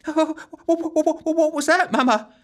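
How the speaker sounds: high, stammering scared wail; frightened wail. A narrator's reading. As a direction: stutter it out in terror, high. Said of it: "wailed Giggles".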